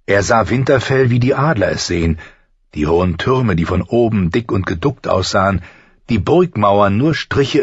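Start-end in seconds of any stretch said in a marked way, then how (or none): none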